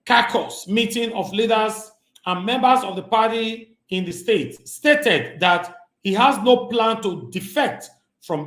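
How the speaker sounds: lightly formal news-reading voice. Acted. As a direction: read the headline like a newscaster, slowed down. Reads news article aloud.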